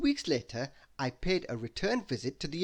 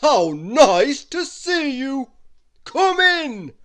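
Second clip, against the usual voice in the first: loud, slow booming giant, pitched high; a giant's big, slow welcome; Wizard Winterbottom welcoming; 11 semitones higher, 13 dB louder, much slower than usual. Acted. Giant wizard welcoming Christmas into his castle, loud and slow.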